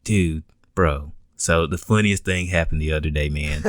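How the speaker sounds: low California surfer dude; California surfer accent. Retold or imitated. Skateboarder telling his homies about a traffic stop, California surfer accent. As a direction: talk like a California skater bro, pitched low. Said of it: "just the California surfer accent these guys had".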